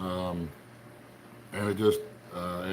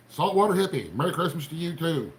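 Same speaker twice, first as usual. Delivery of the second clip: normal talking voice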